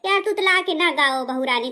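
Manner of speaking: usual speaking voice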